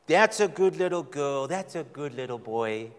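put-on talking down as if to a toddler; condescending coo to a child; mild mock baby talk; patronizing baby talk. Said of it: "in baby talk".

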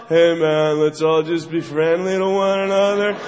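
low, laid-back singsong hippie voice; mellow hippie Jesus. Acted. Jesus preaching kindness to his friends, low and mellow. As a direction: float it out easy and friendly. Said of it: "it was all".

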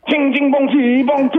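hammed-up fake foreign babble; mock gibberish. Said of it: "He goes".

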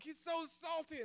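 high, slow teen slang impression; slow high mock kid voice. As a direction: try on the kid word in a squeaky drawn-out voice. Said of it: "I'm like".

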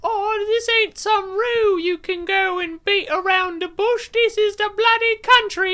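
very high, loud Australian mom gone Cockney; mock-Australian falsetto scolding, slipping into old-school Cockney; bad Australian accent, Cockney-sounding. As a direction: screech like a hammed-up Cockney-Australian mother. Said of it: "you just did a Cockney accent".

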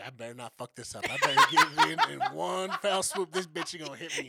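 laughing. Retold or imitated. Imagining the nervous phlebotomist.

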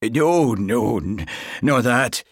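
loud, stammered Highland denial; flustered Highland accent. A narrator's reading. Sergeant hurriedly denying it to constable, flustered Highland accent. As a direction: trip over it in a thick Highland accent. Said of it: "His Highland accent always grew more pronounced when he was flustered".

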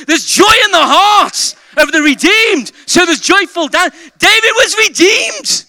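loud, high, impassioned pulpit shouting; rally the congregation at full voice; full-force preaching. Real, not acted. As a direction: build to a fervent, shouted proclamation.

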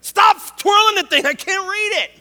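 high, loud call to the guy spinning the sign; mock yell at a sign twirler. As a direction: shout over, half-joking, stop spinning it.

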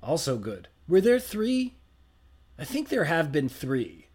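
ordinary delivery

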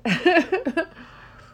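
loud, high burst of laughter. Real, not acted. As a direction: laugh out at the idea. Laughing at own math.

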